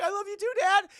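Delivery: high, loud mock girl's cry; hammed-up emotional daughter; tearful daughter. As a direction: wail it back to your father. Daughter answers dying father, retold.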